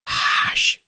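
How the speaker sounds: hushed, whispered exclamation; involuntary cry. A narrator's reading. Miss Boggs silencing her sister, involuntary hush. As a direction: let out a soft, voiceless hush. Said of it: "cried Miss Boggs involuntarily".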